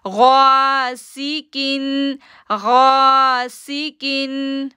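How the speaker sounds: गासिकिन chanted very slowly with गा held two seconds; slow tajweed chant; drawn-out recitation. Shown as a demonstration. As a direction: hold the गा in गासिकिन. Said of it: "तो हमने तनवीन पढ़ी".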